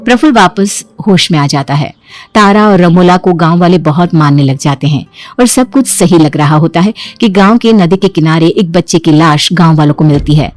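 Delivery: ordinary delivery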